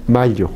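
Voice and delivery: "mayo" said as "mallo", with a palatal l; mayo mal pronunciado. Shown as a demonstration. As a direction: put an l-like contact into "mayo". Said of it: "cuando usted pronuncia mayo, es otra palabra". («mayo» como «mallo», con lengua al paladar)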